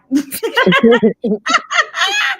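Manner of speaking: loud, high burst of laughter; real uncontrolled cackling laugh. Real, not acted. Host hearing friend's acronym joke, loud high laugh.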